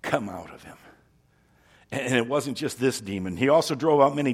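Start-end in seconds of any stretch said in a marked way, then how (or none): 0.0-0.8 quiet, lower stern command. Acted. Jesus commanding a demon out, stern, low and quiet. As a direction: order it out softly but firmly. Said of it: "said Jesus sternly".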